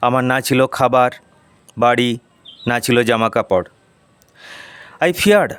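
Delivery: ordinary delivery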